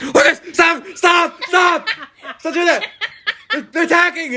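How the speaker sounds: high shrill panicked mom voice, mock yelling; imitating mom. Son imitating his panicking mom at the geese, high mock yell. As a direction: squeal like a frantic mother. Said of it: "She's like".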